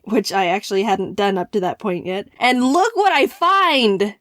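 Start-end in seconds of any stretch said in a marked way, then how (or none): 2.4-4.1 loud, slow, thrilled build-up; excited reveal. Real, not acted. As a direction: drag it out like a big reveal. Discovering slogan on website.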